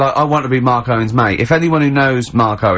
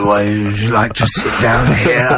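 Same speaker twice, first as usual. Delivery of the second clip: slow, lower, put-on interviewer; sleazy host leaning in; mock interviewer; 4 semitones lower than usual. Mocking interviewer.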